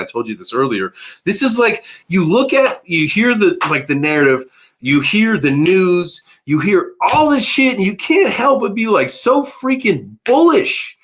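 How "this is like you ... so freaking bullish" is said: much higher, excited rant about being bullish; hyped up. Real, not acted. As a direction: gush with mounting excitement, some words drawn out slowly. Hyped about bitcoin news.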